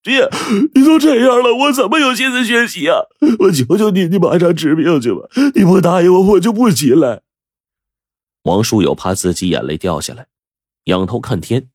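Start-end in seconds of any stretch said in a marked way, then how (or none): 0.0-7.2 high, loud wailing plea; tearful begging. A narrator's reading. Son kneeling before sick father, crying and begging. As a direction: beg desperately through tears, pitched high.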